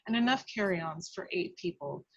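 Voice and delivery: ordinary talk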